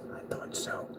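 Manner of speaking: breathy knowing whisper; a quiet told-you-so; smug whisper. Real, not acted. Smug aside after no testing.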